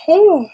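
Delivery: a loud, high yawning whew; big yawn. Real, not acted. The reader yawns mid-livestream, a loud high whew. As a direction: let a real yawn escape. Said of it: "don't know why I'm yawning".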